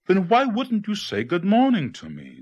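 high-pitched storybook demand; insistent, high questioning. A narrator's reading. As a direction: demand an answer as the snake, pitch well up. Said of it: "demanded the snake".

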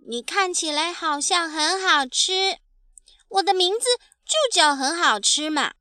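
put-on high, lively child's voice; innocent baby dinosaur. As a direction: explain proudly like a little kid. (天真自豪的小恐龙童声)

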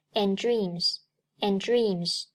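"and dreams" with the d of "and" unreleased; dropped plosive. Shown as a demonstration. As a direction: slowly join "and dreams", swallowing the first d. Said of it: "d，失去爆破".

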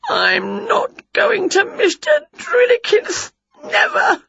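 high, slow stubborn whine; whiny refusal. Acted. Witch refusing to go to the dentist, high whine. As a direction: dig in like a sulking child.

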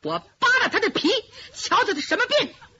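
shrill-edged roar of a rough fighter; strange yell. Acted. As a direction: bark it at your elder like a hothead. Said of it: "一声怪叫".

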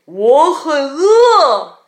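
loud, drawn-out hungry bear roar; bear's roar. A narrator's reading. The hungry bear announces itself, roaring. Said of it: "熊吼道".

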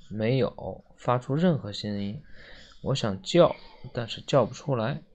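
low, soft, subdued retake; hushed narration. A narrator's reading. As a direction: read it quietly, as if no sound will come. Hushed retake, voice will not come. (压低声音轻声叙述)

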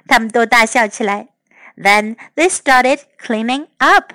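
ordinary delivery, nothing marked

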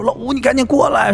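urgent summons. Retold or imitated. Friend phones asking for help, urgent summons. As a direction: voice a friend urgently calling you over.

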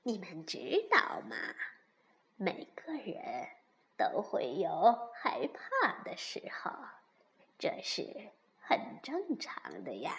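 low, soft, whispery comfort; old granny bear. A narrator's reading. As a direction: reassure the little ones gently. (慈祥地轻声安慰)

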